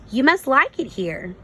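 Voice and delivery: a gentle stranger greeting her; the goose speaking. Acted. Goose greets the butterfly at the pond, gently. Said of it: "he said".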